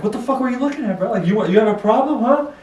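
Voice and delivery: high, fast mock aggressive taunt; mock angry challenge. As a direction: imitate a stranger picking a fight. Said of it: "and he was like".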